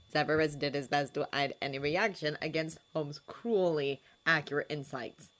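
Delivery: plain reading voice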